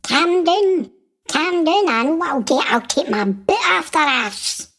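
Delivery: high-pitched indignant rant; comic angry outburst. Acted. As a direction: get worked up, voice jumping high then easing down. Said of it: "I'm getting quite angry".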